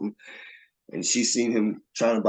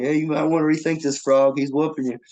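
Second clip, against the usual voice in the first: higher, urgent buddy's advice; imitated warning; 7 semitones higher, 4 dB louder than usual. Buddies telling Frog he's getting whooped, imitated warning. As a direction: warn a friend he's losing. Friends warn Frog off the fight.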